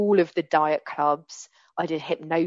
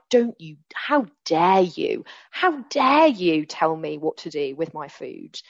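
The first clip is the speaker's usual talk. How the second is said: slow, louder indignant how dare you; defensive; 4 dB louder, slower than usual. Retold or imitated. Snapping back at her husband over the onion juice, indignant. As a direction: reenact her defensive outrage, deliberately. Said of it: "I was so defensive".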